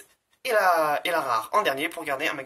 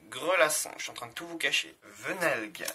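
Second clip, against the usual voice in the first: ordinary talk, nothing marked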